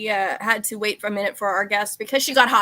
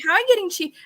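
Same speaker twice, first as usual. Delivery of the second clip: high, louder baffled question; mock incredulous; 10 semitones higher, 4 dB louder, faster than usual. Teen friend asking about cheese on her face, incredulous. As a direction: tease a friend for her messy eating. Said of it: "And I'm like".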